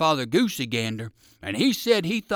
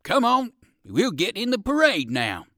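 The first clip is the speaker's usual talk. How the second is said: loud, high, eager summons; urging; 8 semitones higher, 6 dB louder than usual. A narrator's reading. Percival calls the rabbit to join the parade, urging. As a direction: urge him along loudly.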